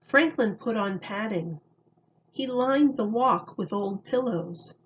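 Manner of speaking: usual speaking voice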